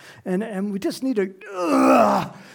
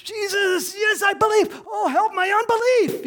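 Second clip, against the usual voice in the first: high, loud, tearful cry for help; a desperate father begging Jesus; crying plea; 13 semitones higher, 3 dB louder than usual. Retold or imitated. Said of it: "And he said".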